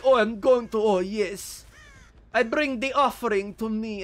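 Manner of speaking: put-on grand vampire speech; mock vampire. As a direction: play a hammed-up vampire demanding tribute. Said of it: "Like every other vampire acts like".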